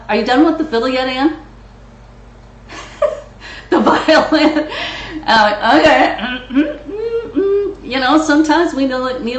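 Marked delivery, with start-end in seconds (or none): 1.4-3.7 quiet breathy burst of real laughter; can't help laughing. Real, not acted. Host laughing at a member playing the violin, real laughter. 3.7-7.8 talking through real laughter, high; words breaking into laughter; laughing. Real, not acted. Host laughing at the violin interruption, real laughter.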